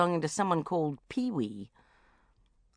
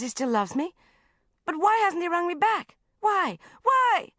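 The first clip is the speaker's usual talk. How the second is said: rising to a frantic shrill; anxious rising questions; 12 semitones higher, 4 dB louder than usual. A narrator's reading. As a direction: spiral into panic, higher and louder. Anxious diary rant building.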